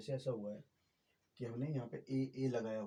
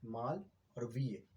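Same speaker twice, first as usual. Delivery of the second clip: slow, split into two syllables; slower than usual. Shown as a demonstration. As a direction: break it clearly into माल and वीय. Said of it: "दो बार करके बोल रहे हो". (धीरे से दो सिलेबल में बोलना)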